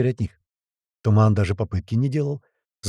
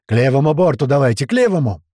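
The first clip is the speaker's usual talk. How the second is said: loud, hailing call, rising higher; shouting; 3 semitones higher, 6 dB louder than usual. Acted. Deckhand guides them to port side, shouting. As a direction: direct a boat from the deck. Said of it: "кто-то с него закричал".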